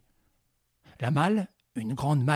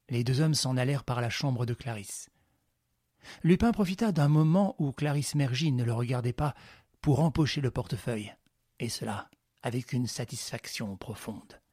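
ordinary delivery, nothing marked

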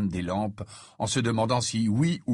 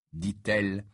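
ordinary delivery

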